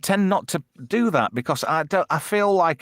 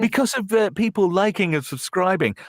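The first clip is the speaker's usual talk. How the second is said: mock-grateful creator, pitched up; insincere gratitude; 6 semitones higher than usual. Streamer parodying creators thanking their subscribers, mock insincere gratitude. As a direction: put on patronizing thanks to the followers. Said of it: "comes across a bit insincere and patronizing".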